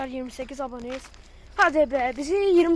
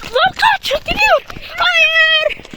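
panicked scream; 7 semitones higher, 7 dB louder, much slower than usual. Real, not acted. Fleeing in panic.

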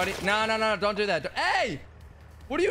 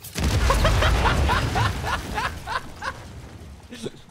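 long, high-pitched burst of laughter; high, drawn-out cackle of laughter; big laugh; 4 dB louder than usual. Real, not acted. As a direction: crack up laughing, high and breathless. Stream prank sets off loud laugh.

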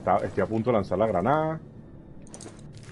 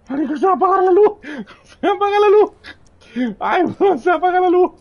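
much higher, squeaky falsetto panic; 8 dB louder than usual. Acted. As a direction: squeal it like a scared kid.

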